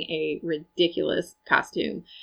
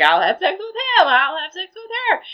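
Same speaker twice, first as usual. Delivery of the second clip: much higher, mock-boastful [name]; mock [name] declaring; 13 semitones higher, 3 dB louder than usual. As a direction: pitch it way up like a show-off rockstar. [name] picks people from crowd.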